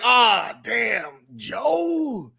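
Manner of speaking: mildly exclaimed mock pity; a hint of mock sympathy. Host voices panel men consoling Joseph, light mock pity. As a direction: play the consoling bro.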